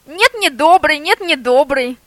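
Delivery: high, loud yapping outburst. A narrator's reading. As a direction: yap it out like a small dog. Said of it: "загавкал прихвостень".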